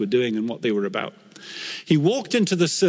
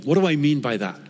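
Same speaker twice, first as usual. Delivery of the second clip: ordinary talk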